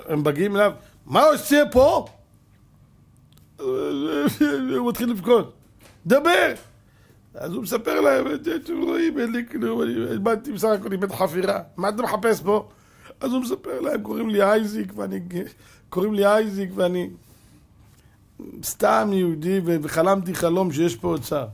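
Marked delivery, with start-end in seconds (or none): 1.1-2.1 high, loud, slow demand; soldier's demand. Acted. Soldier interrogates intruder. 3.6-5.5 tearful narration. A narrator's reading. Narrates Isaac starting to cry. 6.1-6.5 high, loud one-word command; barked order. Acted. Soldier orders him to talk. 8.3-21.5 frightened pleading. Acted. Frightened man pleads with soldiers.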